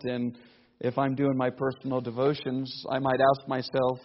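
ordinary talk, nothing marked

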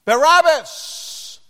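high, loud drawn-out crowd roar; crowd shout. Acted. Crowd answering Pilate, a loud drawn-out roar. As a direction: bellow the name like a mob. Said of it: "they said".

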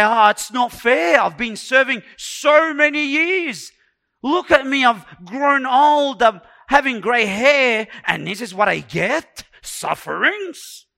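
loud, high aggrieved protest; complaining. Acted. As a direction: whine loudly about how unfair it all is. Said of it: "He didn't complain".

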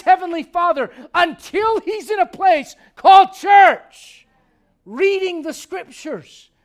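rising to a loud slow preacher's shout; preaching at full force. Acted. Pastor urging the congregation to come to church, preaching loudly. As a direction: drive it home like a fiery preacher.

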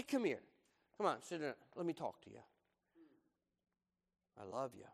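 soft, low, gentle; tender parent. Retold or imitated. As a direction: coax the child in softly. Loving parent to child.

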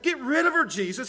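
high, loud, exasperated complaining; annoyed disciples. Retold or imitated. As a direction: whine it out like fed-up followers. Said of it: "So they look at it".

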